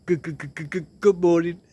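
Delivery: repeated good stuck before morning, lightly mocked; imitating a stutter. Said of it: "he used to stutter".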